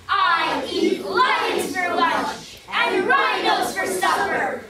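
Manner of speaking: the monster roars its chant at Leopard, slow shouted boast; fearsome monster shout. Acted. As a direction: bellow it to scare the leopard off. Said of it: "a terrible voice rumbled back".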